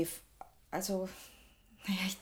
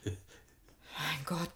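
unvoiced whisper of astonishment; awed whisper; 4 semitones lower, 8 dB quieter than usual. Secretary whispering, stunned at the stolen painting. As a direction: breathe it out in disbelief.

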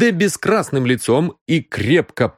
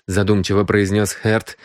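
ordinary delivery, nothing marked

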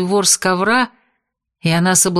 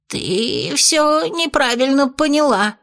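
испуганное оправдание; 7 semitones higher, slower than usual. Acted. Бес в панике оправдывается.

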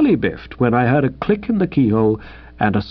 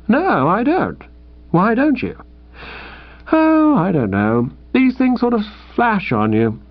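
put-on higher, sheepish young man; lightly raised voice of an awkward young suitor; Tuppy's voice; 3 semitones higher than usual. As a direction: hedge and dither like a lovesick chap. Tuppy talks to Bertie.

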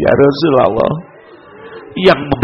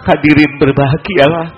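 higher, urgent preaching voice; impassioned preaching; 6 semitones higher than usual. Acted. Preacher telling the iftar congregation they still weep, impassioned plea. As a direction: lift the voice, tearful and urgent.

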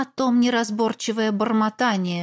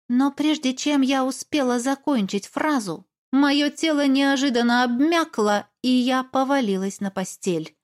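ordinary delivery

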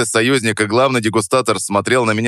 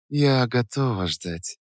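drawled, flirtatious; 3 semitones higher, 7 dB quieter, slower than usual. A narrator's reading. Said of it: "протянула Саша". (игриво и томно протягивая слова)

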